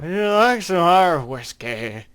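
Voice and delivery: loud, high tipsy offer; drunk voice. Shown as a demonstration. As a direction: sound like you've had a few. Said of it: "Say it like you've already had a lot".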